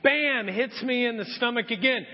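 usual speaking voice

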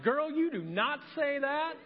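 hint of a stern mother; scolding mom. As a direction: lightly imitate a mom telling her off. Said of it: "She's like".

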